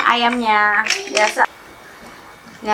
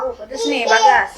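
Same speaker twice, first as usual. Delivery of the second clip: high surprised squeal; surprised at spice; 12 semitones higher than usual. Real, not acted. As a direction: react to the heat, startled. Reacts to spicy sauce. (kaget kepedasan, nada tinggi)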